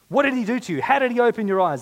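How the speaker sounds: high, loud questioning; dramatic interrogators. Acted. Preacher voices accusers questioning healed man, high and loud.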